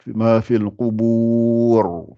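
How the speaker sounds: Ma fil qubur chanted very slowly in recitation style, final ra full; Quran recitation demo. Shown as a demonstration. Teacher reciting the verse for students, slow chant. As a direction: intone it like a reciter, drawn out. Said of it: "Voilà".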